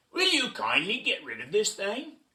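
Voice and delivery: pushy storybook villain; bossy request. Acted. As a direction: order it with mock politeness. Monkeys order the eagle.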